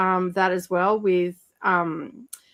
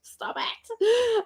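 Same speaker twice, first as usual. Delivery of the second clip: laughing disbelief; 12 semitones higher, 4 dB quieter, much slower than usual. Real, not acted.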